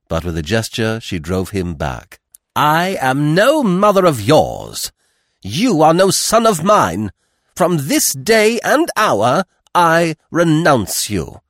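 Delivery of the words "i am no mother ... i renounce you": high, loud, slow pronouncement; furious renunciation. A narrator's reading. Mother renouncing her son, in slow ringing fury. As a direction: disown him with every word weighted.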